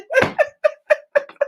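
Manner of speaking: real delighted high laughter; high burst of laughter. Real, not acted. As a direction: crack up at the memory. Laughing at the prayer.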